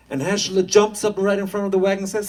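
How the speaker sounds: narration climbing high and loud; rising excitement. A narrator's reading. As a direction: build it up as he leaps out.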